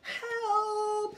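high sung-out cry for help; scream for help. Acted. Brother wakes from his own nightmare, high scared scream. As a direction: wail it high like a frightened cub. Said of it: "he screamed".

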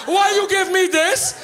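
put-on high, agitated accented yell; mock yelling dad. Dad yells at his kids about the video, alarmed. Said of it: "as he's yelling".

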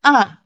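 short "A" falling sharply from high; fourth tone. Shown as a demonstration. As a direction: drop "A" quickly from the top. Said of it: "imitate the tones".

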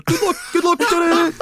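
put-on repeated high, loud Echt-accent call; imitated Echt accent. Whole of Universal Studios copying his relatives, high Echt accent. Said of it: "talking like they're from Echt".

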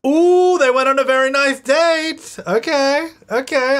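squealing high with delight, slowed; thrilled, high-pitched gushing; excited. Real, not acted. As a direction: gush over the couple's date. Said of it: "I'm excited by that".

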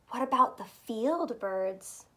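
ordinary delivery, nothing marked

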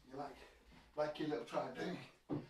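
quiet cooing; soft aside to dog. Real, not acted. Talking softly to dog.

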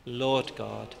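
ordinary talk

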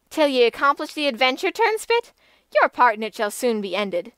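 mock him as a mere cook; contemptuous taunt; scornful. A narrator's reading. Damsel scorns kitchen-boy knight.

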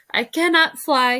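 narrated sob, lightly acted; mild sob. A narrator's reading. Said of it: "he sobbed".

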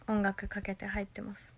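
soft whispered aside. Real, not acted. Telling chat about her bath habit in a whisper. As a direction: whisper it confidentially.

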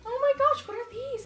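high oh my gosh; high delighted gasp of words; excited. Real, not acted. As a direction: squeal it out high. Finding gifts in box.

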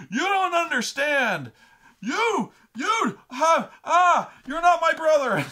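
high, screamed, sputtering accusation; hammed-up hysterical fury; red rage. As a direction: imitate a brother screaming himself incoherent. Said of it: "going into the Red Rage".

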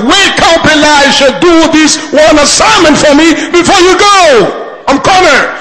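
high, loud, urgent pleading to a dying prophet; desperate prayer. Acted. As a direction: plead as if cornered with no helper. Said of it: "Did you pray with desperation?".

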